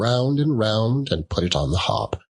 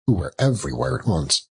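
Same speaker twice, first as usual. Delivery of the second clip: plain reading voice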